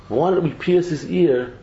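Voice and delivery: ordinary delivery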